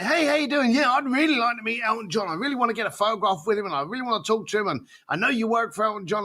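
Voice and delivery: high, rushed fan voice tumbling out fast; eager caller. Retold or imitated. Second caller gushing about meeting [name], high and fast. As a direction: gush like a starstruck fan in a hurry.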